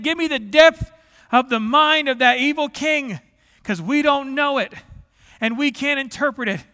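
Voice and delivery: high, loud, urgent appeal; imitated pleading. Preacher voicing the men facing the king, pleading. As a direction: cry out the desperate request. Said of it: "let's talk about the pleading they did".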